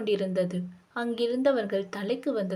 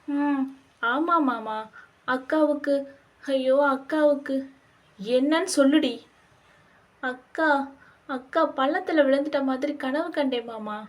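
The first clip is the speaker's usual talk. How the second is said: higher, halting, broken-up telling; tearful distress; 4 semitones higher than usual. Acted. Frightened wife recounts nightmare of sister falling, tearfully distressed. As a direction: falter anxiously between short phrases.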